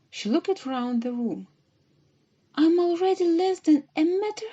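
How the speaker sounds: panicking as she shrinks; Alice cries out as she keeps shrinking, high and loud; alarmed. A narrator's reading.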